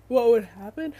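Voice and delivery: talking through a real yawn; loud, stretched words as a yawn takes over; mid-yawn. Real, not acted. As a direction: let a yawn swallow the words. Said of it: "sorry, I had to yawn".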